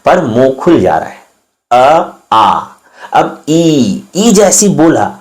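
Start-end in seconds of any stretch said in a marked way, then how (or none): 1.7-2.6 अ then आ, the mouth opening on the second; अ closed, आ wide open. Shown as a demonstration. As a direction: contrast अ and आ from the navel. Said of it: "नाभि से ही हवा निकल रही है".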